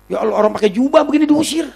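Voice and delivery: high-pitched exclamation of disbelief; retold indignant dismay. Preacher exclaiming at being thrown out, high and indignant.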